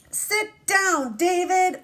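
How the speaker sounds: strict teacher. Acted. Teacher reading David's teacher ordering him to sit, high and loud. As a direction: snap him back to his seat.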